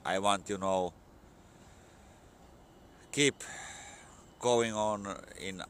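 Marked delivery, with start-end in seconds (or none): none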